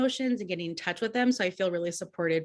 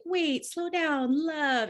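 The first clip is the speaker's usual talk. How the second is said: slowed right down, higher and soothing; gentle slow-down plea; 7 semitones higher, much slower than usual. Retold or imitated. Cancer energy telling you to slow down, soothing and unhurried. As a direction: ease the words out like a gentle hand on the shoulder. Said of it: "it's like".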